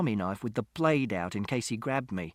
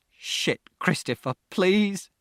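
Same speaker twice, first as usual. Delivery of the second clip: tearful pleading; 6 semitones higher, slower than usual. A narrator's reading. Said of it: "tears dripping off his face".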